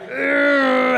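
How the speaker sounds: high exasperated groan; reluctant ugh. Retold or imitated. Pastor recalling wife's advice, groaning reluctantly. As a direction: groan like you know she's right.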